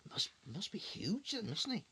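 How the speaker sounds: ordinary delivery, nothing marked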